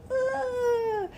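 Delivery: imitated high, thin wail; high drawn-out "ah". Mother reacting as baby is walked past, wailing. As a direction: let out a held, high cry.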